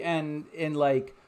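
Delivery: normal talking voice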